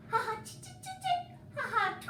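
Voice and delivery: very high laughing chirps; twittering bird call; swallow chatter. Acted.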